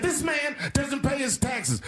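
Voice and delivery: hammed-up outraged yell; mock Hillary shout.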